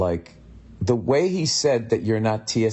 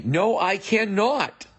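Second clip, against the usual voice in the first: slow, high, drawn-out NO, I CANNOT; mock stiff refusal; 11 semitones higher, much slower than usual. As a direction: refuse officiously, stretching every word of "cannot". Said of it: "who says it like that".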